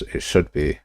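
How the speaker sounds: ordinary talk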